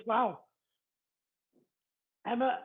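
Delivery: usual speaking voice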